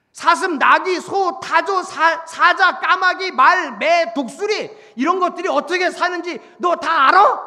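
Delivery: shouted roll-call, high and hard; loud, high-pitched interrogation; hectoring list. Acted. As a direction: hammer out each item, then demand an answer. God listing animals at Job.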